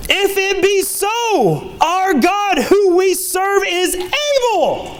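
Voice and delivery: high, slow, drawn-out proclamation; stretched preacher's cry, pitched high; voice climbing high. Real, not acted. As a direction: let excitement carry the pitch way up. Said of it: "My voice just went so high".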